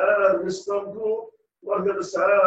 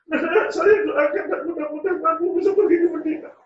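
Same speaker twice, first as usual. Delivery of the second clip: mother's tearful blessing; 13 semitones higher, 6 dB louder than usual. Acted. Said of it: "Ibunya nangis".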